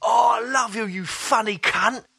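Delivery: put-on high, slurry drunk fan; lightly voiced drunk admirer; drunk stranger voice. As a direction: gush like a stranger with too many beers. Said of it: "he was like".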